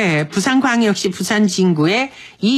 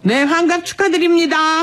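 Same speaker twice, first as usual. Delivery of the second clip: much higher, celebratory call; bright congratulation; 8 semitones higher than usual. Real, not acted. Radio host congratulating listener's wife on sixtieth birthday, celebratory. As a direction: congratulate with a big lifted pitch.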